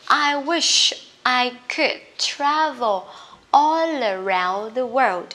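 slow, drawn-out teacher's demonstration; slow model reading. Shown as a demonstration. Teacher models the wish sentence for students, slow and drawn out. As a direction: say it slowly for learners to follow.

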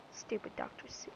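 a very soft breathy aside under the breath; whispered grumble at the game's letters. Real, not acted. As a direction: mutter it to yourself, barely voiced.

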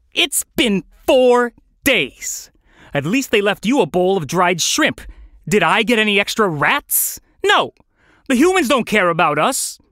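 high, exasperated hungry rant; fed up, urging a dim companion to act; snappish. Acted. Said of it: "Hunger made Felix snappish".

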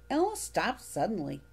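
plain reading voice

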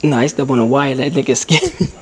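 ordinary talk

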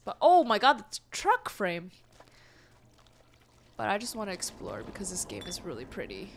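ordinary talk, nothing marked

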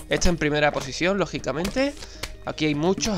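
ordinary talk, nothing marked

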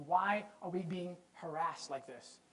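slow aggrieved murmur to the Lord; hushed slow plea. Retold or imitated. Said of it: "and he said".

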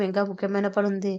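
plain reading voice

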